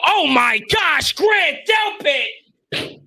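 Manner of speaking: stretched-out, high, loud shout of disbelief; real stunned yell at a huge tackle; excited shout. Real, not acted. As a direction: yell his name at the big hit, drawn out. Big hit on the field.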